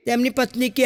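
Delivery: ordinary delivery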